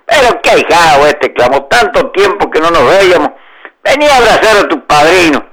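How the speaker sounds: effusive. A narrator's reading. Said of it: "haciéndose el efusivo".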